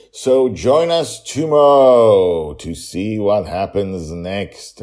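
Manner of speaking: slow, loud trailer-voice sign-off; dramatic announcer. Acted. Host inviting listeners back in a slow loud trailer voice. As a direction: stretch it out like a movie trailer.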